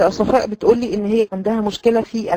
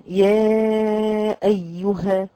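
slow, drawn-out recitation; Quranic recitation; much slower than usual. Shown as a demonstration. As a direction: stretch it out like tajweed. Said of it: "ممكن يقول إيه؟".

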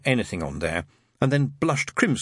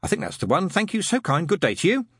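higher, polite but rattled; Aziraphale voice; 8 semitones higher than usual. A narrator's reading. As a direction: gush thanks while mind races. Aziraphale ends call, distracted.

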